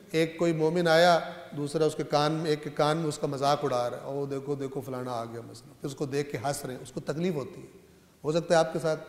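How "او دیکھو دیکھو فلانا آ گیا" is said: low, much softer voice, as into someone's ear; quiet, lowered gossiping aside; hushed mocking aside. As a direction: imitate a sly remark passed between friends. Imitates mockers whispering in an ear.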